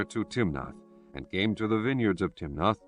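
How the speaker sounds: ordinary delivery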